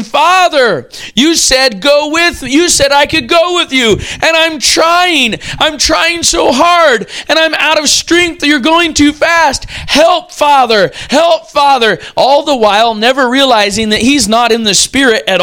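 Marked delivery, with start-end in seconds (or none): 0.0-12.0 put-on higher, drawn-out plea of a boy falling behind; pleading exhausted child. Boy calling to his father as the car pulls away, strained pleading. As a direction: act out a struggling child begging for help. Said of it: "he's gasping for air, and he's saying".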